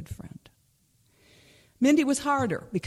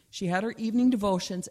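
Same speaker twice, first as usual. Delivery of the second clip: plain reading voice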